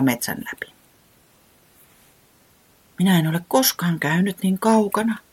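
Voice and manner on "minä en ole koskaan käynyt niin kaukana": small voice. A narrator's reading. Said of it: "sanoi pienellä äänellä".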